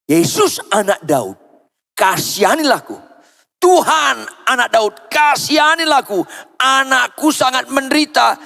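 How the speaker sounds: high-pitched pleading cry. Acted. Desperate mother calls to Jesus for her daughter, crying out. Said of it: "teriak lagi".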